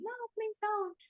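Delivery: high-pitched plea at normal volume; mock pleading yell. Game master voices the lady yelling downstairs, mock high plea. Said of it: "the lady was yelling".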